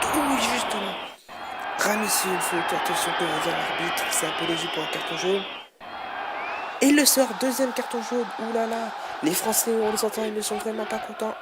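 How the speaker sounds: loud, quick foul call; excited sports commentary. Real, not acted. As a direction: react hotly to the card. Foul and red card. (commentaire sportif animé)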